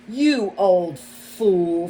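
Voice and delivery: slow, loud, hissing contempt; hissed insult. A narrator's reading. Fox hissing at the mole who spilled honey, slow and loud. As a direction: spit it out slowly at the old fool. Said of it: "Freddy hissed".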